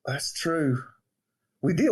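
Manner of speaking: usual speaking voice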